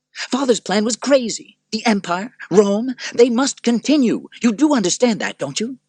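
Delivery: high, loud self-justifying emperor; petulant Commodus. Acted. Commodus justifying himself to his sister, high and loud.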